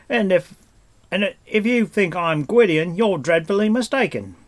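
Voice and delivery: high, loud protest; shouting back. A narrator's reading. Stranger dodging Taran's sword and protesting, in a high shout. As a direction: call it out indignantly. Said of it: "the stranger shouted back".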